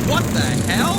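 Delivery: startled. Acted. Sudden shock at crawling bots.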